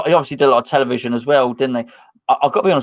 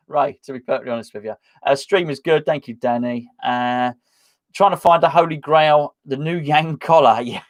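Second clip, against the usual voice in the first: normal talking voice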